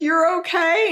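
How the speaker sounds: high rising disbelief; surprised question. Retold or imitated. Sister to brother amazed, high rising question. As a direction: check that he really means it. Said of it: "And I was like".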